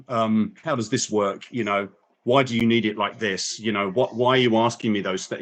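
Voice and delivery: usual speaking voice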